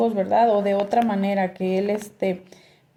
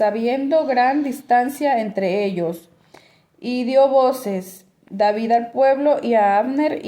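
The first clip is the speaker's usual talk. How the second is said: scripture reading; 3 semitones higher than usual. Acted. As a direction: read the passage out to the congregation. Reads scripture passage aloud. (lectura bíblica en voz alta)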